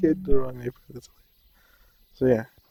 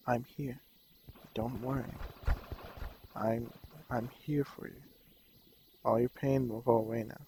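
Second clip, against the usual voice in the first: soft, tender reassurance, much quieter; gentle comfort; 9 dB quieter than usual. Retold or imitated. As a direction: hush it like comforting someone in pain. Jesus comforting a hurting girl.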